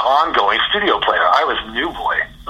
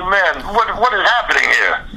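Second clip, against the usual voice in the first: higher-pitched retold exasperation; exasperated conductor; 9 semitones higher than usual. Conductor halting the failed take, mock exasperated. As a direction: complain in mock frustration at the players. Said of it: "And he's really".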